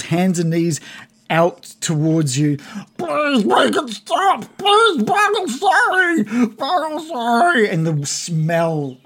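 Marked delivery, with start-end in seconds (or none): 3.0-7.7 high, wailing goblin begging for mercy; hammed-up weeping apology; blubbering. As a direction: plead in a stretched, tearful squeal. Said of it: "he's blubbering".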